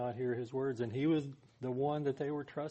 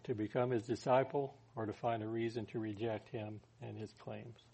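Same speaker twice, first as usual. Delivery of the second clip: usual speaking voice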